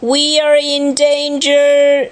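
slow, high alarm call; acted shout of danger; storybook shout. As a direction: call out the warning high and drawn out. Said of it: "shouted Zog".

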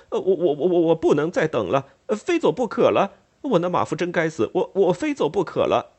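stumbling over every I in a panic; flustered, embarrassed. Acted. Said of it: "越发觉得狼狈不堪".

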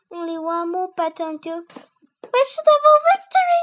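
loud, high rallying cry of triumph; triumphant. A narrator's reading. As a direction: announce coming victory like a general. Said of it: "I'm a genius, thought Harry, smirking".

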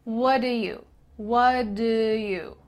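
"What do you" with the T of what cut out; dropped T. Shown as a demonstration. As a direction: link "What" straight into "do you", no T. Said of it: "The T in what is cut out".

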